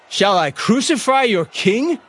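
high, slow, rising question; challenging question. Acted. Pilate questions the shouting crowd, challenging. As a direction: throw the question back at the mob.

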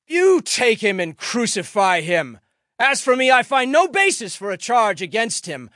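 loud, high, emphatic refusal; forceful retort. A narrator's reading. Pilate refusing the priests' demand, loud and forceful. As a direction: throw it back at a shouting mob. Pilate argues back at the mob.

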